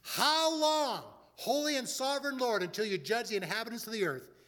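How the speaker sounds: high, pleading cry of the martyrs. A narrator's reading. Martyrs cry out to the Lord, high pleading call. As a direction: lift it high like a cry from under the altar. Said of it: "cried out in a loud voice".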